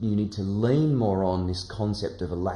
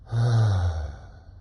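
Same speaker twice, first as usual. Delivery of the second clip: relaxed schwa; 3 semitones lower than usual. Shown as a demonstration. Teacher leads the class in relaxed recoil sounds. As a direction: let each "uh" drop out easily. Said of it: "make an er sound out".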